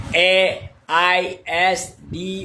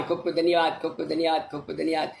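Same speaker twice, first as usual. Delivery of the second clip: fading thanks; 5 semitones lower, 7 dB quieter, faster than usual. Real, not acted.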